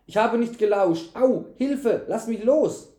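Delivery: high-pitched, loud pleading; wailing. A narrator's reading. Servant struggling in knight's grip, wailing protest. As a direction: wail and protest to get free. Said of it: "jammerte der Knecht".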